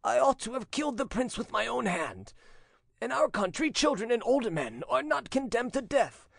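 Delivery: put-on high-pitched, anguished old father; tearful voice. A narrator's reading. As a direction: beg forgiveness on the edge of tears. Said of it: "he said in a tearful voice".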